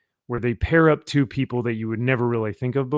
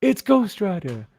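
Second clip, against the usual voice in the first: higher, excited street guy calling his friend; hammed-up passerby; mock New York bro; 8 semitones higher, 4 dB louder than usual. Retelling the comic, bystander calls to Vinny in a mock bro voice. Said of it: "it's like".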